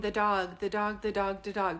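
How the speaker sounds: normal talking voice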